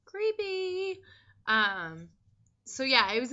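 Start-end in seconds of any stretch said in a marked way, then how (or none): 0.1-1.0 squealed creepy. Real, not acted. Reacting to the eerie reading.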